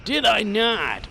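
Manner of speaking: loud, slow mock outrage; loud indignant. Real, not acted. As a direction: draw it out indignantly.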